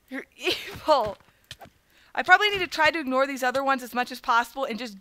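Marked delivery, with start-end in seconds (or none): 0.1-1.2 slow, with a laugh breaking in. Pretend. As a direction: laugh through the word evil. Repeats accusation, then laughs.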